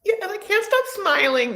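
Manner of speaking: high, gushing and delighted; giddy like a bride who can't stop smiling; mock giddy bride. The happy bride she did not sound like, high gush. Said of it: "It isn't like".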